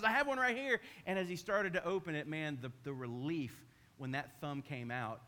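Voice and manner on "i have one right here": higher, louder eager helper; eager neighbor. Retold or imitated. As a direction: hand it over eagerly. Neighbor grabs crowbar.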